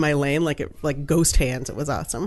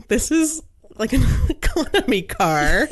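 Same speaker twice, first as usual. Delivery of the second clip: laughing disbelief; 5 semitones higher than usual. Retold or imitated. Shocked at tiny rental car.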